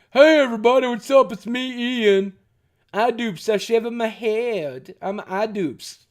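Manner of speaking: mock the figure as a bald YouTuber; Idubbbz impression. Streamer lightly voicing the figure as Idubbbz. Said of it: "looks like Idubbbz after he shaved".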